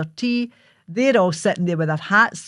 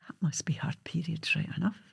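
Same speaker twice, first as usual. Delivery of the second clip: whispered thought; 11 dB quieter than usual. Retold or imitated. Mother's private realisation.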